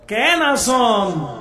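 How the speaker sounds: very slow Chittagonian কেন আসন with a laugh; Chittagonian greeting. Shown as a demonstration. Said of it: "চট্টগ্রামের ভাষা হলে কেমন হবে". (চট্টগ্রামের টানে ধীরে কেন আসন)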